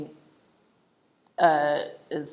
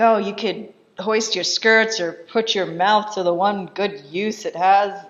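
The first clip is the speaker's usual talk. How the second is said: louder, scornful retold tirade; hammed-up yelling, raised then slowing at the end; mock angry yell; 3 semitones higher, 7 dB louder than usual. Marquis berating his wife, mock angry yelling. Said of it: "he's yelling at her".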